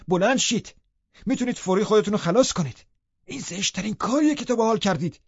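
high-pitched, agitated scolding; exasperated servant's tirade; angry. A narrator's reading. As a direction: scold them frantically, voice pitched right up. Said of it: "با عصبانیت گفتم".

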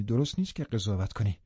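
plain reading voice

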